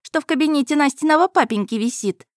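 put-on high, brisk demanding girl; pushy girl. A narrator's reading. Girl ordering that a carpet be taken, high demanding voice.

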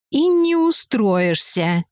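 slow, NE stressed hard; ominous pronouncement. Acted. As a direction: declare it, weighing each word. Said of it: "неожиданно заявила ведьма".

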